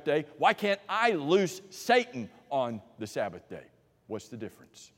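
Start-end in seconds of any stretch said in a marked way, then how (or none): 4.1-4.9 quiet punchline. Acted.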